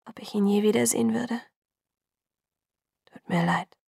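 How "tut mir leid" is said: barely voiced whisper. A narrator's reading. Jacob whispering goodbye to Bella, barely audible. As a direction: breathe the apology almost silently. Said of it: "flüsterte er so leise".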